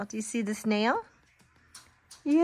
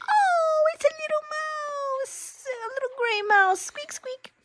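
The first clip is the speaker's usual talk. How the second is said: tiny mouse-like voice; 12 semitones higher, 3 dB quieter than usual. Acted.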